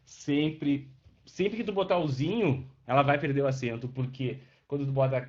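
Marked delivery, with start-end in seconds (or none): none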